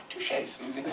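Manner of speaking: soft, breathy touché to Susie; mock touché. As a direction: concede it under your breath. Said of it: "You got the name wrong".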